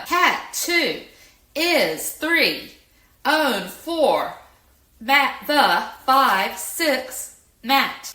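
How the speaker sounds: each word set apart, high and loud; high, loud, word-by-word count. Shown as a demonstration. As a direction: punch every word and number separately, like a student counting aloud. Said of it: "So the student's able to say".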